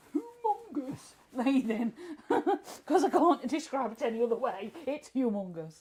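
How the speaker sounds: laughing through the words; loud, giggling while talking. Real, not acted. Laughing about huge lathe.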